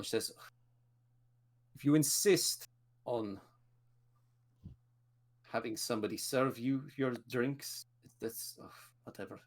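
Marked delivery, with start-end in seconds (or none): 1.8-9.4 formal lady. Acted. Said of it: "being very formal".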